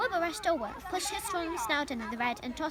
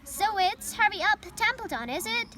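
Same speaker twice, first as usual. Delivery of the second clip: imitating Wilbur; 6 semitones higher, 5 dB louder than usual. A narrator's reading. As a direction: parrot the pig's plea to mock it. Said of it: "imitating Wilbur's voice".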